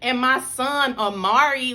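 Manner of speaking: ordinary talk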